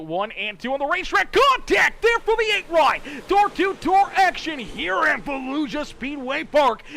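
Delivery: high, loud race call, shouted at the contact then easing slightly; excited play-by-play. Real, not acted. Race announcer calling door to door action, excited shouted play-by-play. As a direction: erupt at the crash and keep the energy up.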